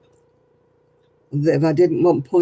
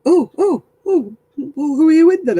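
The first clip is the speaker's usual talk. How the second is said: flustered scam caller; 11 semitones higher, 5 dB louder than usual. Retold or imitated. Flustered scammer mimicked.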